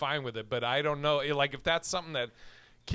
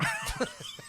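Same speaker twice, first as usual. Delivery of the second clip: high squeal laugh; 5 dB quieter than usual. Real, not acted. Laughing at own joke.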